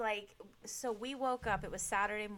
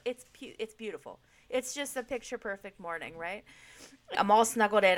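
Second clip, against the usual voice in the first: ordinary delivery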